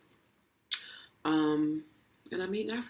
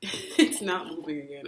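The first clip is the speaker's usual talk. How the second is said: nervous laugh; 4 dB louder than usual. Real, not acted. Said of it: "That's the nervous laugh".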